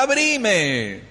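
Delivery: high, drawn-out call falling away; pleading Peter outside. Retold or imitated. Peter shut outside by Rode, pleading. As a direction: beg to be let in. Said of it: "Y el otro afuera, con frío".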